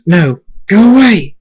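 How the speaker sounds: high, loud, drawn-out yell; desperate shout. A narrator's reading. Thunderstorm surrounded by six wolves, shouting them away. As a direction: yell to drive attackers off.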